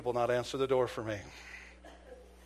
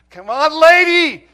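loud, much higher plea; pleading shout; 13 semitones higher, 21 dB louder, slower than usual. Retold or imitated. Officer urging the guilty neighbor, loud pleading shout. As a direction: beg her to do it, full voice. Begging her to knock.

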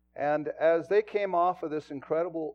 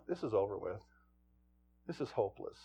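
despairing father; 5 semitones lower, 6 dB quieter than usual. Retold or imitated. Father voiced despairing over son.

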